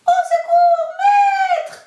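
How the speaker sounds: very high, loud sung cry; the golden harp crying to its master; harp crying for help. Acted. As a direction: call out shrilly like a magic harp. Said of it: "chanta l'instrument".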